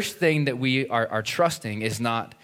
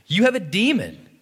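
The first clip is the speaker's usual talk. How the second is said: accusing crowd; 10 semitones higher, 5 dB louder than usual. Retold or imitated. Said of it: "The crowd answered".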